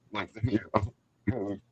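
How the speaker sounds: muffled voice, rinsing coffee pods at the sink. Real, not acted. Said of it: "you sound muffled".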